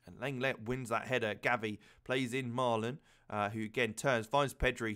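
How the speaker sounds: ordinary talk, nothing marked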